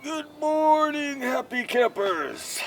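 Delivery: high, slow sing-song greeting; slow high-pitched good morning; cheery wake-up call. Pretend. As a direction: greet the campers like a camp counselor. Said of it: "cheery wake-up greeting".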